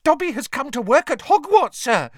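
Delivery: high, loud, excited elf squeal; squealing house elf. A narrator's reading. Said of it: "Dobby squealed excitedly".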